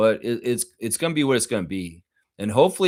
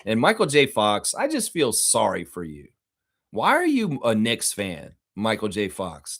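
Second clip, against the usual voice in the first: ordinary delivery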